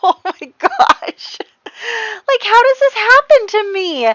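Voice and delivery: high, wailing disbelief; exasperated. Real, not acted. Player whose sim died twice: high exasperated wail. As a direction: cry out to the heavens in disbelief.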